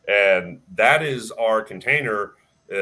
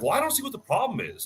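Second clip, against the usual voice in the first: much higher, clueless mock voice; mock clueless member; 12 semitones higher than usual. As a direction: play the oblivious member up high. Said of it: "he was like".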